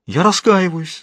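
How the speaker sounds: high, imploring confession; tearful pleading. A narrator's reading. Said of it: "из глаз его текли слёзы". (слёзная мольба высоким голосом)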